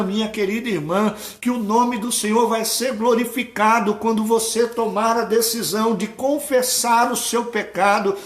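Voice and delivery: lauter werdende, drängende Predigt; volle Predigt. Real, not acted. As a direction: rufe der Gemeinde Gnade zu. Preacher builds to full force.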